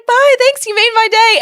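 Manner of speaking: high, loud, bubbly farewell; sing-song friendly goodbye; cheery goodbye. Retold or imitated. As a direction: chirp it happily. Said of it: "she was like".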